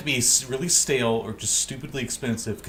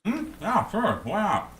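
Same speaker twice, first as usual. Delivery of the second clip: high goofy voice; 10 semitones higher, slower than usual. Acted. Character answers in put-on voice.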